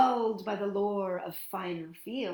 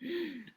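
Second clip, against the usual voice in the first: quiet, high intake of breath; short storyteller's gasp; 8 semitones higher, 6 dB quieter than usual. Acted. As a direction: gasp in mock alarm. Troll sees the big goat.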